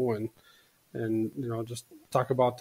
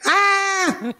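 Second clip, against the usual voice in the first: very high shrill mock scream; beaver scream; 5 dB louder than usual. Guest imitating the screaming beaver from a commercial, very high shriek. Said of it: "It's like".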